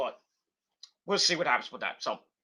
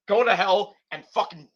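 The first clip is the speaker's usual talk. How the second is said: normal talking voice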